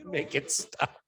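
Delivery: mock-snobbish, high-pitched pleading; high mock-disgusted French snob; snotty mockery. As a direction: whine in exaggerated snooty dismay. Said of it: "so snotty about that".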